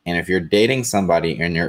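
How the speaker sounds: ordinary delivery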